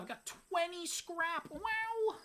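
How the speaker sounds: high-pitched, squealing wow; over-the-top excited squeal; exaggerated amazement. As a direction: marvel at the haul in a high, hammed-up voice. Celebrating a big haul of loot.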